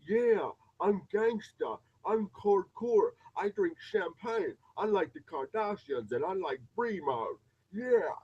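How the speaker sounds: high, loud, slow mock tough-girl voice; mocking reality-show girl. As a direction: ham up a reality-TV diva acting gangsta. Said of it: "this girl with some fake old fucking hair".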